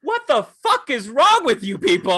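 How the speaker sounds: high, loud outraged yell; retold shouting. Being pulled away from the group, shouting in outrage. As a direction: re-enact yelling at them in disbelief. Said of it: "me shouting".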